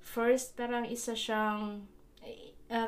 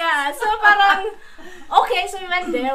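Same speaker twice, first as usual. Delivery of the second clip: laughing; 9 semitones higher, 14 dB louder than usual. Real, not acted. Starts story while laughing.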